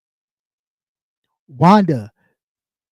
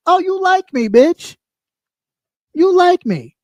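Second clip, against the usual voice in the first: much higher, teasing sing-song; sarcastic realization; 9 semitones higher than usual. Real, not acted. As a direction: taunt her with a sly, high-pitched gotcha. Mock realization of a crush.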